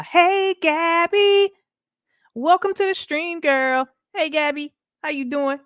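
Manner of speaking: high, loud, delighted hello; light up as she greets a favorite; excited greeting. Real, not acted. Streamer delightedly greets Gabby arriving in chat, excited.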